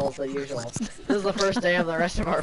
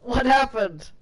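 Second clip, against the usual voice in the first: shocked; 8 semitones higher, 6 dB louder, slower than usual. Real, not acted. Boss vanished.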